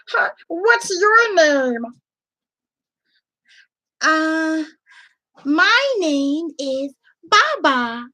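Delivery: high, slow puppet voices chatting; squeaky puppets meeting each other; two puppets talking. Shown as a demonstration. Two puppets introducing themselves to the class, high and slow. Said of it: "having a conversation".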